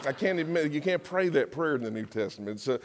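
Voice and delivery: ordinary talk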